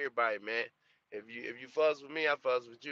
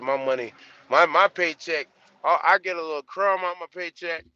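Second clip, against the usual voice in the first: louder, higher protest over the paycheck; heated emphasis; 6 semitones higher, 10 dB louder than usual. Real, not acted. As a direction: push it out, fired up. Venting about small paycheck.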